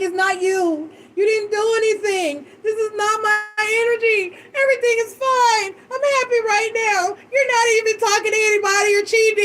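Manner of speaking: retold exasperated plea, pitched high; lightly acted distressed protest; frantic reassurance. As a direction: insist in a high, worked-up voice. Retelling her frantic reassurance to partner.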